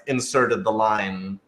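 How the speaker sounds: usual speaking voice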